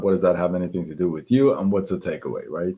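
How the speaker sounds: usual speaking voice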